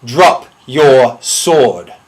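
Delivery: very slow, loud, word-by-word order; commanding. Acted. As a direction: command it with a pause after each word. Final command to duel opponent.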